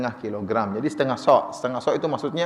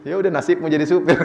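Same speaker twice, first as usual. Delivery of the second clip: joking reply breaking into laughter; laughing. Retold or imitated. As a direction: tease through a laugh. Jokes back at the complaining driver. (sambil tertawa geli)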